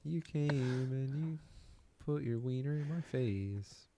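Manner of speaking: high, slow, whiny put-on voice; mock high silly voice. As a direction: whine it like a silly cartoon. Mocking voice for the fighter.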